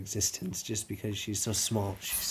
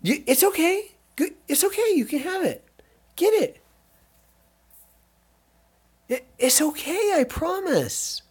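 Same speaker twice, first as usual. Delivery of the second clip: coaxing pet voice; 7 dB louder than usual. Real, not acted. Owner coaxes scared dog to take treat, high cooing voice. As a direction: coax a shy dog gently, way up high.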